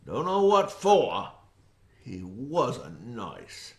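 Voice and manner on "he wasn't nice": hushed, slow. Acted. Ominous understatement.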